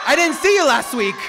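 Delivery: loud joking call-out from the pulpit; teasing call-out. Acted. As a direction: call someone out for a laugh. Teasing a churchgoer.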